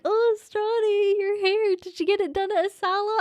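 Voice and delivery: high-pitched imitation of a concerned neighbour; put-on neighbourly concern, pitched well up; fussing neighbour. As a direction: coo it high, stretching the opening words. Neighbour reacting to botched fringe.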